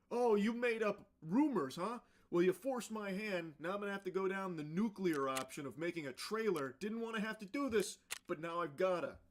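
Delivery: high, loud mocking impression of a fake tough streamer; mocking her. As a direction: ham up her fake bravado. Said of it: "that's her response to it?".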